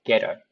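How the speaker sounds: 'get her' run together, t tapped like r; flapped t. Shown as a demonstration. Teacher applying the flap rule, 'get her' with tapped t. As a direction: link 'get her' as ge-rer. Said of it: "모음 사이에 트가 들어가니까".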